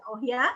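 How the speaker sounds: "Oh ya" swung up at the end; questioning rise. Shown as a demonstration. Teacher comparing to an Indonesian question, rising tune. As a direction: lift "ya" in "Oh ya" like asking. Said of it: "seperti kita bertanya".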